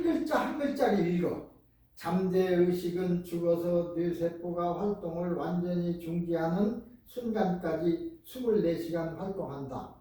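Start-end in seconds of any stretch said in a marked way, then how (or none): 1.9-9.9 slow letter-by-letter reading. Shown as a demonstration. Teacher showing students how slow readers read, slowly. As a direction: read it out slowly, one syllable at a time. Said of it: "요렇게 읽어".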